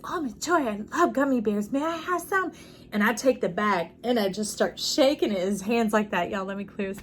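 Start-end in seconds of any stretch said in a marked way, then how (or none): 0.0-2.5 put-on high, bouncy little-kid voice, rising up; exuberant child. Storyteller voicing a bubbly little brother, high and eager. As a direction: play an eager little boy begging sweetly. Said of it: "just a real exuberant child".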